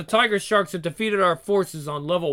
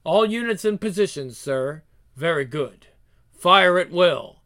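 usual speaking voice

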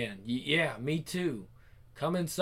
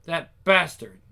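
angry curse; 7 dB louder, slower than usual. Acted. Angry after being used.

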